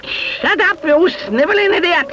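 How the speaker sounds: high furious witch shout. A narrator's reading. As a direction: snap it at the coward. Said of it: "shouted Fenella".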